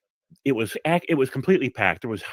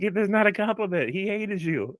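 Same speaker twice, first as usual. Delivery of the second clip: laughing boss's reply; 10 semitones higher than usual. Retold or imitated. Boss voice continued.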